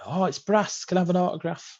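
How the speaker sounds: high, gushing fan voice; mock fan asking for an autograph; starstruck fan. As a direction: squeal it like an excited fan. Fan asks player for autograph.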